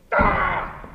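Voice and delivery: a loud, high mock "Ah!" of a kid crashing in; mock charging yell. Coach mocking a reckless blocking kid, loud mock cry. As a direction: yell it like a wild lineman, poking fun. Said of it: "So the kid doesn't go".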